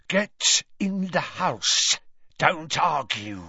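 slow, drawn-out hissed order; menacing, slow serpent voice; hissing Parseltongue. A narrator's reading. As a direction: hiss the command like a snake. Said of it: "weird hissing noise".

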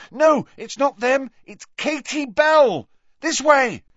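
loud, high urgent reply; urgent shouting. A narrator's reading. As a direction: shout back over the wind and lead the way. Harry shouts back over the wind.

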